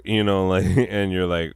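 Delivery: ordinary talk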